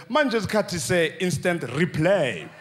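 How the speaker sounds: slowed-down announcer voice; slow-motion replay call. Retold or imitated. Sportscaster reading the replay, dragged out slow. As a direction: drag the words out like a replay.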